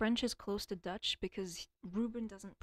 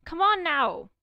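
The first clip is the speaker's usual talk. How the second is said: loud, high fed-up groan; exasperated; 7 semitones higher, 11 dB louder, slower than usual. Real, not acted. Streamer complains about the coworker, loud and fed up. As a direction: plead to nobody in exasperation.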